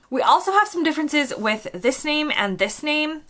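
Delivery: normal talking voice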